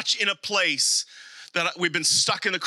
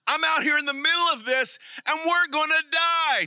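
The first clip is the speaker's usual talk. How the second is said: high-pitched acted panic; hammed-up cry of doom; panicked disciple; 8 semitones higher than usual. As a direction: wail it like you are about to drown. Acting out panicked disciple in storm.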